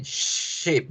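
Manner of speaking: "ship" opened with a long shushing sound; hushing sh. Shown as a demonstration. Teacher confirms a student's answer, long hushed sh in ship. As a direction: draw out the sh of "ship". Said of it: "Con una hacemos el silencio".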